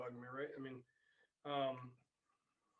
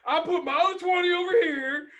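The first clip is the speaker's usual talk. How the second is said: high, loud, thrilled imitation of his daughter bragging; excited daughter shout; 13 dB louder than usual. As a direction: shout it like a proud kid showing off a bargain. Daughter bragging about cheap Corvette.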